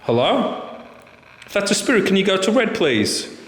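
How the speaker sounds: high, coaxing call into the dark; talking to a ghost. Real, not acted. Addressing spirit via K2 meter.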